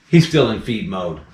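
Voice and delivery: ordinary delivery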